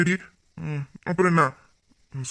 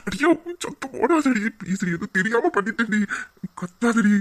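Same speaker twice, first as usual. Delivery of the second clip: urgent, high-pitched hushing; panicked pleading; 8 semitones higher than usual. Acted. Man begging his crying lover to hush, panicked.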